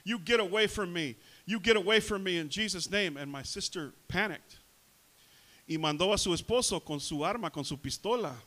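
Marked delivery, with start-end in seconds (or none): 0.0-3.2 raised, forceful imitation of mom yelling; retold shouting. Preacher imitates mom rebuking Satan on the phone, shouting. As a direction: order the intruder out, starting loudest. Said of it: "she was shouting and she said".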